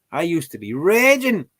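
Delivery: hamming up being furious; mock rage. Shown as a demonstration.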